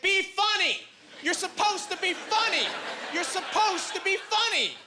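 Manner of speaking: high, slow, yelled demand; mock heckler yell. Drunk heckler in the second row yells at the comic, high and slow. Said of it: "she starts screaming at me".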